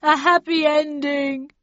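high, loud, drawn-out lament; acted sobbing cry; tearful wail. A narrator's reading. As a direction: wail it slowly in self-pity. Sobbing villain finishes his confession.

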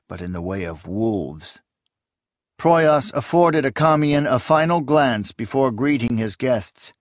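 plain reading voice

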